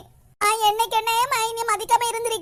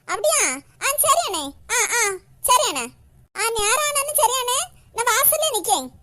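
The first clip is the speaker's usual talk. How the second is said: tearful. Acted. Sister crying on phone call.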